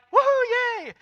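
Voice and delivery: high, loud, whooping "woohoo, yay"; hammed-up burst of delight; mock cheer. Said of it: "isn't like".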